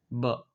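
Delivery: ब as a short "buh"; letter sound ब. Shown as a demonstration. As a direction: give ब as B's bare sound, "buh". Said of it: "किस चीज़ का sound आ रहा है". (B की छोटी ध्वनि "ब")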